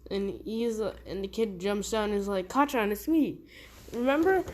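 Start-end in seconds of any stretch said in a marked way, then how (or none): 2.5-4.4 higher and louder, begging to be remembered; eager pleading. Acted. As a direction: reach out to him, urgent. Deku pleads with old friend.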